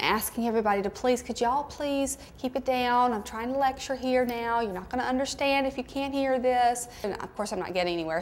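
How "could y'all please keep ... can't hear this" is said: put-on sweet, higher polite Southern accent, softer. Teacher pleading with noisy students in polite Southern accent. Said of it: "my most, you know, polite Southern accent".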